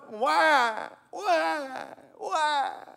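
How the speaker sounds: put-on high, wailing baby cry on each word; imitating crying baby. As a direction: wail it like a hungry infant. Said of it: "They cry".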